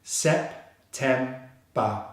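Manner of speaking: "Sep, tem, ba" as three separate beats; syllable by syllable. Shown as a demonstration. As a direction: break "Sep, tem, ba" with a pause after each syllable. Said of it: "So we have all three syllables together".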